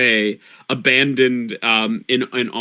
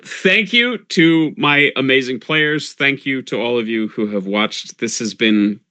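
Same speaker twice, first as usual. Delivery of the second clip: normal talking voice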